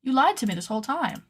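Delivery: dramatic pretend outrage; mock betrayal. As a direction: accuse them with hammed-up hurt. Mock accusing her sewing patterns.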